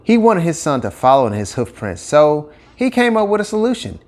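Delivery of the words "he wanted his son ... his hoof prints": ordinary delivery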